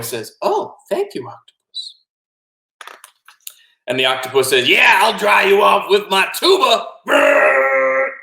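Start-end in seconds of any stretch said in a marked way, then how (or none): 0.4-2.0 put-on grateful boy. Boy gratefully accepts being dried. 4.7-6.9 high, loud excited shout; gleeful octopus. Acted. Octopus gleefully reveals the tuba. 7.1-8.1 loud, high buzzing blast; imitated tuba blast.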